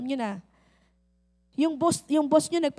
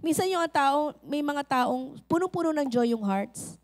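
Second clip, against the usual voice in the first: usual speaking voice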